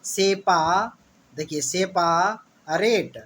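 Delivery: "सेपा" with a long "पा", then "रेट" apart; drawn-out spelling pronunciation "सेपा... रेट"; said as written. Shown as a demonstration. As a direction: break it into "से-पा" and "रेट". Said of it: "जैसा लिखा हुआ है वैसे ही".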